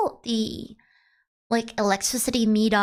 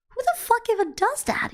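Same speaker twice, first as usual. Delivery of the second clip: outraged squeak; high incredulous; incredulous pitch jump; 13 semitones higher than usual. Retold or imitated. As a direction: voice leaps high in disbelief. Outraged reaction retold.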